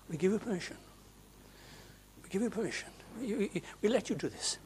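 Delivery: soft, quick sneering imitation of the prayer; mock their words under your breath; mocking parody. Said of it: "How dare they say that?".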